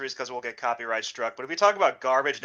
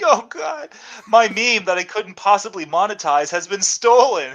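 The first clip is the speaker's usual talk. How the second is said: high, loud sarcastic lament; mock anguish; 5 semitones higher, 6 dB louder than usual. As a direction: wail over a trivial loss as if ruined. Mock outrage over a stolen meme.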